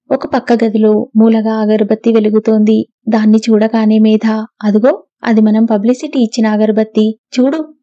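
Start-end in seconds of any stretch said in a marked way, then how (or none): none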